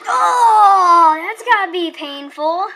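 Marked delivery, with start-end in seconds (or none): 0.1-1.2 loud, high wince; pained cry. Real, not acted. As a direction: cry out in sympathy. Bird hits a boulder.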